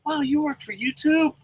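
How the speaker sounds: high, gushing fake-sweet flattery; butter him up in a sugary high voice; fake-nice gushing. Fan buttering up a Google employee, sugary and gushing. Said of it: "I'm like".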